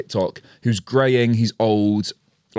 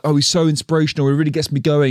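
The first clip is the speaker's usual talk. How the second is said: mock fan gush; 6 semitones higher, faster than usual. Mocking gushing fans.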